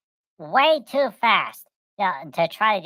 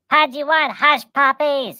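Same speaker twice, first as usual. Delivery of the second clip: high, slow, louder little-creature voice; a small pet begging for food, pitched up; Pudgy's voice; 8 semitones higher, 5 dB louder, slower than usual. Retold or imitated. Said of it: "because Pudgy was like".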